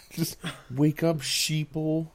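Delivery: hammed-up conspiracy nut; conspiracy theorist. Mocking conspiracy believers.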